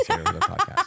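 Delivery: mimicking Des in a high giggle; squeaky laughing imitation. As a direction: squeak the quote out through a laugh. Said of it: "Des was like".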